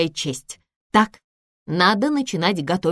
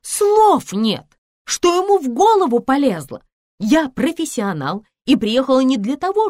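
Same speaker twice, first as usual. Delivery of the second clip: high, louder indignant outburst; scandalised, raised-pitch exclaiming; outraged; 5 semitones higher, 4 dB louder than usual. Acted. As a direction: protest in high dudgeon. Outraged after his advance.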